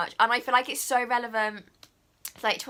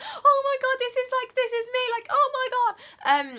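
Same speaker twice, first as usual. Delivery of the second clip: very high, squeaky awe; overwhelmed squeal; 5 dB louder than usual. Retold or imitated. Vlogger reliving the movie moment, very high squeal. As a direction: gush like it's hitting you all at once.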